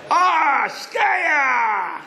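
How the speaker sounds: high, drawn-out cry of delight; jubilant shout. Retold or imitated. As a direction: shout it out and stretch the ending. Yossel exults at the news.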